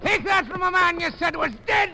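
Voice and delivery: high shout of triumph; fighting shout. Acted. Returned suitor yells at his rival mid-fight. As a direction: yell it as you land the punch.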